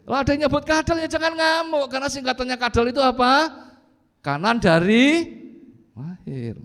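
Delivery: loud, high-pitched preaching; forceful preaching. Real, not acted. Preacher telling congregation not to rage at a teasing nickname, forceful preaching. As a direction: raise the voice high and rouse the congregation.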